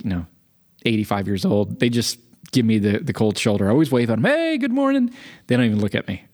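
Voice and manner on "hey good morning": high, cheery hello, retold; friendly greeting. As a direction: call out a high-pitched neighbourly greeting. Cheerful greeting to cold neighbors.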